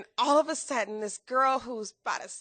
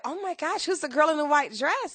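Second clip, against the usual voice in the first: higher, lightly acted crowd excitement; a touch of starstruck wonder, pitched up; imitated onlookers; 6 semitones higher, 3 dB louder than usual. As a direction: mimic curious onlookers buzzing. Voicing onlookers at the red carpet.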